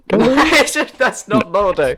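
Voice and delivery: high laughing disbelief. Real, not acted. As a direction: laugh through the words. Laughing denial of a guess.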